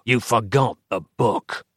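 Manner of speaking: cold hissing layered whisper; menacing rasp of a burnt doppelganger. Acted. Dark fraction speaks.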